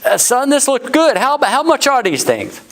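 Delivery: high, mock voice of the crusty exec; crusty oil executive demanding the price; gruff old executive. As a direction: imitate the impatient veteran asking price. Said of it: "he goes".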